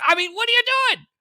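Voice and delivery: high and loud disbelief; incredulous shout. Real, not acted. Host shouting at Republicans' strategy, incredulous. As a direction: demand to know what they're thinking.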